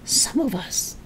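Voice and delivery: throaty witchy whisper; old woman whispering. Acted. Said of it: "whispering in a throaty sort of voice".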